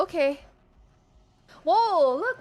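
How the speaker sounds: ordinary talk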